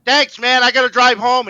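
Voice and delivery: high, loud retold protest; mock sarcastic thanks. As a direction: act out calling after someone in comic complaint. Retold complaint after being sprayed with beer.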